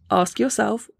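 normal talking voice

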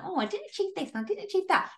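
high, quick mock whine; hammed-up poor-me complaint; mock pity party. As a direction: imitate someone wallowing in self-pity. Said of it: "sat in a pity party".

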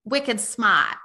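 put-on SMART drawn out as "smaht" in a Boston accent; very slow Boston "wicked smaht", no R. Showing friends how Boston would say it, Boston accent. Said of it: "in Boston they would say".

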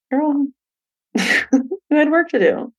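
wise future self. Retold or imitated. Future self teasing her past self.

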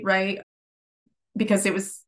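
normal talking voice

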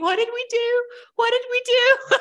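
high, laughing disbelief; high, giggly what-have-we-done; half-laughing panic. Retold or imitated. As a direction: squeal it out like a shocked new mom.

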